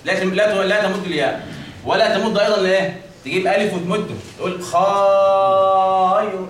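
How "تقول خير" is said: خير stretched out far too long; wrong lengthening. Shown as a demonstration. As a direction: drag the vowel in خير. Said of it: "هذا خطأ وهذا خطأ". (مد خاطئ)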